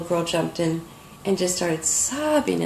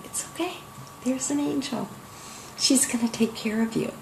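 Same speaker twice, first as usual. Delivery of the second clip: higher and much softer, a soothing murmur; gentle reassurance; 4 semitones higher, 8 dB quieter than usual. Pretend. The art quietly telling a frightened little girl she will be cared for, in a high, hushed, reassuring murmur.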